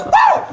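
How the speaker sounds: sudden high falsetto whoop; silly high-pitched woo. Retold or imitated. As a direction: let out a squeaky woo. Sudden shift in mood.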